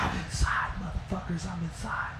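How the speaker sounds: hushed, sneaky boast. Retold or imitated. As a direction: whisper it like you're hiding. Sneaky boast from behind the counter.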